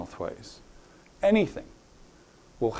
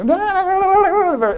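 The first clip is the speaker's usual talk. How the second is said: frantic high-pitched gabble, much louder; high-pitched fast voice; 7 dB louder than usual. Acted. Teacher mimics how a stressed person sounds, high-pitched frantic gabble. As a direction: gabble it like someone wildly stressed. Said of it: "High-pitched, fast voice".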